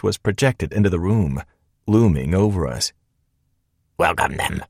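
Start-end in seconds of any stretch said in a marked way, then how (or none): 4.0-4.6 low, distorted machine hiss; hissing electronic static. A narrator's reading. Glitching hologram greets the intruders, hissing static. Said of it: "hissed out ... almost like angry static".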